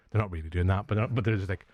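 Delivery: ordinary talk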